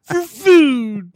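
high, slow, dazed stoner; lazy burnout drawl, stretched out. Acted. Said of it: "Why do we do that as the stone voice".

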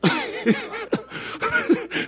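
high airy giggle; laugh. Real, not acted. Preacher laughing after joke about women. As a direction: laugh at your own joke.

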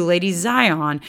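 ordinary talk, nothing marked

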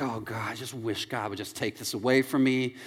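low, hushed groan to God; mock regretful prayer. Regretful prayer after an epic blunder.

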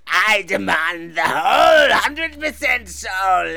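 slow, shouted demon demand; drawn-out theatrical devil roar. Pretend. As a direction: wail it out as a hammed-up Satan. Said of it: "I'm the devil".

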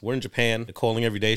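ordinary delivery

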